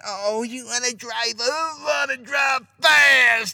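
high, slow taunting mimicry; mocking voice. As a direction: sneer it out, then yell it. Mocking a tailgating speeder.

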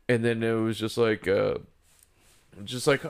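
ordinary talk

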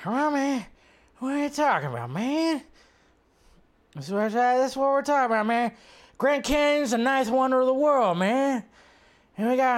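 put-on high, far-off voice of a rambling old man; Biden impression. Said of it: "doing that Biden impression".

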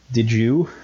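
usual speaking voice

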